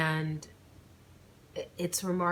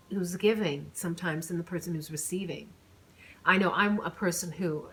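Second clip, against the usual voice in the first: usual speaking voice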